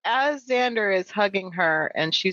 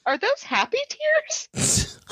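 high, slow little question; acted naive question; mock sheepish realisation; slower than usual. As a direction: ask it high and drawn out, as if only just catching on. Acting Dawn's dawning realisation.

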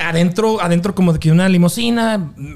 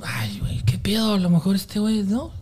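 hushed, breathy aside to himself; whispered thought; 7 dB quieter than usual. Retold or imitated. Concert-goer wondering about the guy, whispered. Wary onlooker's private thought.

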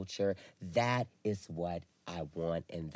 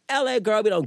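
exclaiming; 12 semitones higher, 8 dB louder than usual. Real, not acted. Complaining about LA.

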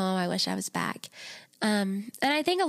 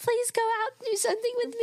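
fast, high-pitched begging plea; high whiny pleading; 13 semitones higher, faster than usual. Retold or imitated. Daughter begging her sleepy mom, high whiny plea. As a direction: beg like a bored kid.

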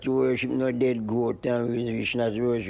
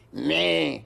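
drawn-out, higher goat's "meh"; goat bleat; 4 semitones higher than usual. Retold or imitated. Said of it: "go meh".

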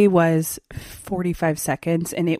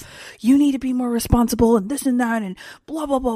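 higher, quick frustrated scold; mock scolding; 5 semitones higher, faster than usual. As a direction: scold her like a fed-up boyfriend. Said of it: "he's like".

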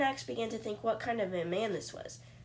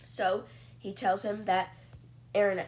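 usual speaking voice

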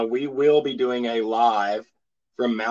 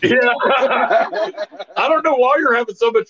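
laughing mock ride worker; 8 semitones higher, 3 dB louder than usual. Plays the push guy, laughing.

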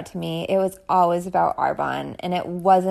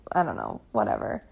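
weary sigh; 9 semitones lower, 7 dB quieter, faster than usual. Retold or imitated. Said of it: "I was just so frustrated".

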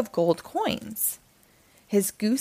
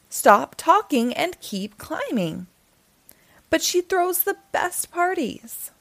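put-on higher, pleading boy's voice; Jack's protest; 7 semitones higher than usual. Jack pleading with the narrator, higher boy voice.

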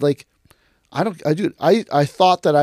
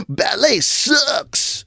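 high, drawn-out mocking jeer; exaggerated dumb hater, dragging it out high; ignorant heckler; 10 semitones higher, much slower than usual. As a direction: stretch each word out in a high mock yell. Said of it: "they go".

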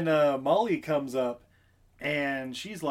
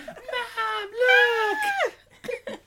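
put-on high, loud, giggling child's shout; excited kid call; 4 dB louder than usual. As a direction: squeal for mom's attention.

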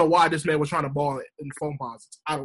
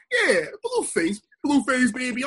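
high, drawn-out rapper ad-lib; mock squeaky rapper intro; 7 semitones higher, slower than usual. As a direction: stretch it out high, imitating a rapper's tag.

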